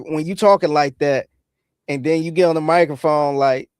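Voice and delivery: ordinary talk, nothing marked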